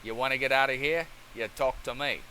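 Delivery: mock Australian Mad Max accent. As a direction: talk like a movie tough from Australia. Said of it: "talking like we're from Australia".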